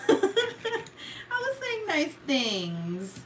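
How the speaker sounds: high, very slow, laughing insistence; drawn-out protest. Real, not acted. Defending insults to sister, drawn-out laughing protest. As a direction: stretch it out high through laughter.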